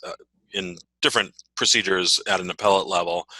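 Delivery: ordinary talk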